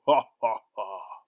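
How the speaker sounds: booming evil ogre laugh; a loud, villainous cackle; horrible laugh. Acted. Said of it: "a really horrible laugh".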